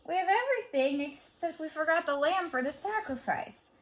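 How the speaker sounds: put-on high, eager little boy's call; child Isaac. A narrator's reading. Isaac calling to his father, high child voice. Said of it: "Isaac asked".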